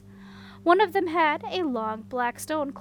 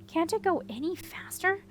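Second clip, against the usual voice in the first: ordinary delivery, nothing marked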